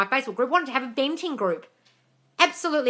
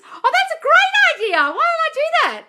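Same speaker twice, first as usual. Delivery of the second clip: light up at a great idea; excited delight; 9 dB louder than usual. Real, not acted. Host delighted by a viewer's idea, high excited exclaim.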